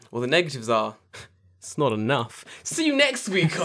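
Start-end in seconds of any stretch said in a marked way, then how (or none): 2.6-3.7 high, laughing through the sign-off. Real, not acted. Mock podcast sign-off, laughing to listeners. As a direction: crack up while saying bye.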